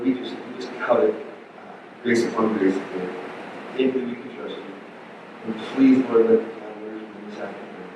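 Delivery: closing prayer for the congregation, hushed and sincere. Acted.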